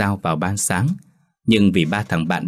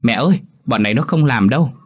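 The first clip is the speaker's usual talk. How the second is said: shouting to his mother offstage, higher and louder; 5 semitones higher, 4 dB louder than usual. Acted. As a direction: call out loud to tattle on friends. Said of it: "Thiện chống nạnh hét lớn". (hét to gọi mẹ mách tội)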